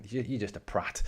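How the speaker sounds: normal talking voice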